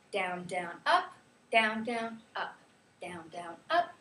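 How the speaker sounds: two low beats on "down", then a separate "up"; measured count of the beat, "down, down, up"; rhythm pattern. Shown as a demonstration. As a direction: chant "down, down, up" in threes. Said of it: "the rhythm for that goes".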